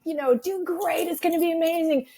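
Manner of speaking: high-pitched upbeat pep talk, imitated; cheerleader encouragement. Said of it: "my cheerleader encouragement".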